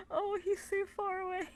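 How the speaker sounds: high, whimpering coo; adoring. Real, not acted. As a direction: pretend to cry over the cute plush. Cooing over tiny plush.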